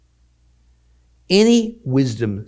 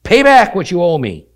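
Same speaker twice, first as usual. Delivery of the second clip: much higher and louder, a furious demand; angry shout; 8 semitones higher, 11 dB louder than usual. Acted. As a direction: bark the debt demand at him.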